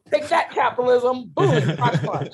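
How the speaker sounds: loud, high, playful attack shout; mock battle cry. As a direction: cheer each blow like a cartoon fight, with a laugh. Mock battle cry against capitalism.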